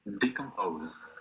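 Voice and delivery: Decompose said slowly as dee-kom-POHZ; slow, careful Decompose; model pronunciation. Shown as a demonstration. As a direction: spell out Decompose slowly for the class. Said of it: "Se pronuncia decompose".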